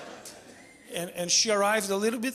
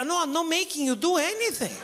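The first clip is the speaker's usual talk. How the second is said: defensive high protest; 8 semitones higher than usual. Retold or imitated. Defensive retold reply.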